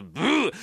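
loud blown fart sound ブー; fart noise. Acted. The patient breaks wind under the doctor's press, a loud fart noise. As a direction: blurt a big raspberry like a fart.

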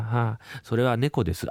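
ordinary talk, nothing marked

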